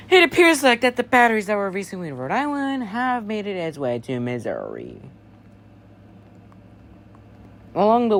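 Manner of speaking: steady official broadcast voice; emergency alert read. Acted. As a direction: read it like a police warning on air.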